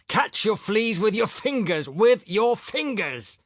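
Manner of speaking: high, loud, furious shouting; furious cry. A narrator's reading. Irritated professor yelling at the scruffy man about fleas, furious. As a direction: bellow it in a fury, dragging the last words. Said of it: "cried furiously".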